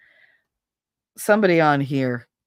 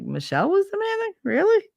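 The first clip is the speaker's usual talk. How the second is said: much higher, incredulous question; mock disbelief. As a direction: act out his stunned disbelief. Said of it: "He was like".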